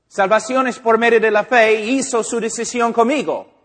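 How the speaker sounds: mock believer loudly defending his convert; insistent defensive protest. Believer loudly insisting to the preacher, mock defensive.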